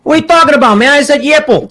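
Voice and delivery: high indignant Chicago voice; loud, high, offended Chicago guy; hammed-up Chicago accent. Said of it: "do your Chicago accents".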